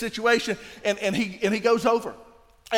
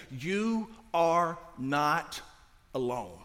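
very slow, word by word, softer and lower; solemn reassurance; 6 semitones lower, 7 dB quieter, much slower than usual. Real, not acted. Preacher looking each listener in the eye, slow tender reassurance. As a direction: let each word land gently, dropping on the last.

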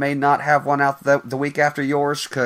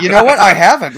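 excited; 5 semitones higher, 10 dB louder than usual. Real, not acted. Excited reaction to movie talk.

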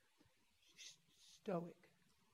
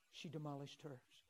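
ordinary delivery

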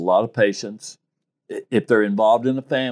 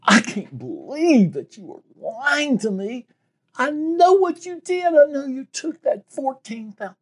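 raised voice. Retold or imitated. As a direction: confront her hard, voice way up. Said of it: "and raised my voice".

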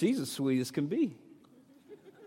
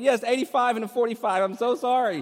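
higher, louder imitation of his crying wife; tearful admission; 11 semitones higher, 9 dB louder than usual. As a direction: answer the cop through tears. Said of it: "And she's crying".

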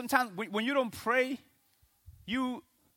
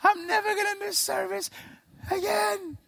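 high pleading bargaining voice; desperate panic prayer; 12 semitones higher than usual. Retold or imitated. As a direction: beg God in a panic, pitched high. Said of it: "How many of you guys have prayed".

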